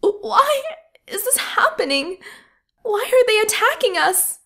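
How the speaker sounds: a sob breaking into a high, desperate why; crying out through tears; sobbing. Acted. Nurse sobbing at soldiers attacking her ward, high crying plea. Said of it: "A sob leapt unbidden from her chest".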